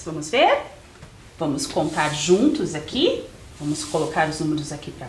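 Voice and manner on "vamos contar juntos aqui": ordinary talk, nothing marked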